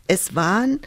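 plain reading voice

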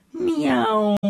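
a high, drawn-out playful meow; cat meow. Acted. The tomcat announces himself to the mice with a meow. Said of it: "schnurrte da eine Stimme".

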